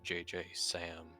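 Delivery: usual speaking voice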